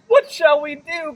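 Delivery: high, loud panicked question; frightened cry. Acted. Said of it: "cried Sister Bear".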